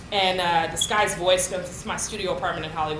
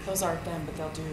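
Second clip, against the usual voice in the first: low-key, let-down concession; disappointed; 3 semitones lower, 8 dB quieter than usual. Retold or imitated. Mother judging daughter's cheap pearls, quietly disappointed. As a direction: settle for it quietly, voice sinking away. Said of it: "all kind of disappointment in her face".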